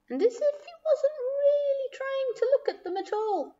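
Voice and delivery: very high, soft sing-song narration; feigned nonchalance. A narrator's reading. Narrator describing someone pretending not to look, coyly. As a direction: float it up high and quiet, all innocence.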